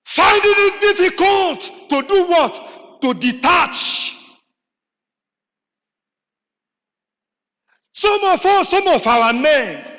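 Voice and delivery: slow, rising sermon delivery; slow emphatic preaching. Acted. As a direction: lean on each phrase to the hearers. Preacher's rhetorical question, slowed.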